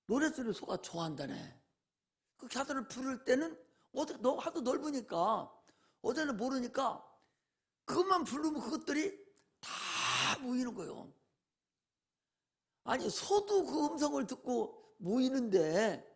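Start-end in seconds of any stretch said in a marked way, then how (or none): none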